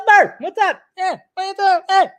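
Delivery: high, punchy Boston-comic greeting; Bill Burr impression. Shown as a demonstration. Host showing off his Bill Burr voice. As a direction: bark a quick hello like Bill Burr. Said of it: "That's my Bill Burr impression right there".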